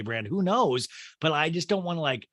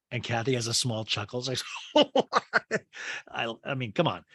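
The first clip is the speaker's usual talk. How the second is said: ordinary talk, nothing marked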